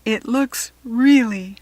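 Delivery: awed and a little scared; nervous whisper-shout of awe. A narrator's reading. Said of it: "Kirsty exclaimed nervously".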